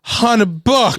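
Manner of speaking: mock drunk guy. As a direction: squeak it out mocking the drunk bettor. Mimicking the drunk guy's bet.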